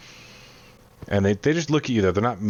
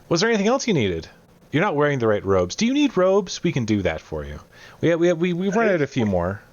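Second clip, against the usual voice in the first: higher-pitched, eager cook voice; helpful cook; 7 semitones higher than usual. Acted. Cook offers robes to the visitors, high and eager to help. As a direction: offer help in a lifted, lively voice.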